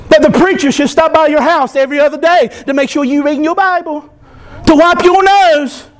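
ordinary delivery

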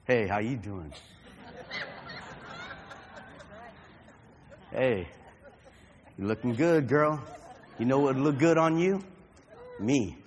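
put-on low, slurry drunk pickup lines; sleazy drunk hitting on a girl.

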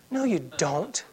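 usual speaking voice

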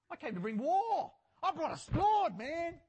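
loud, high quoted proclamation; excited preacher's shout. Retold or imitated. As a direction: shout the quote out, carried away. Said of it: "I'm screaming at you".

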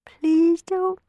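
put-on high, pleading boy; begging. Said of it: "Sammy repeated".

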